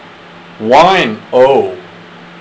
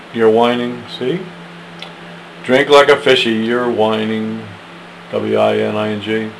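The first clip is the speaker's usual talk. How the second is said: usual speaking voice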